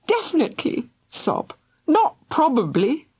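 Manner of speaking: high, protesting between sobs. A narrator's reading. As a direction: push the words out through crying. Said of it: "exclaimed between sobs".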